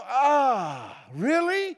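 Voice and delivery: high, doubting "really?" as a hammed-up listener; mock disbelief. Skeptical listener doubting the simple point, mock disbelief. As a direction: scoff like it's too simple to be news. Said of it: "and you're gonna go".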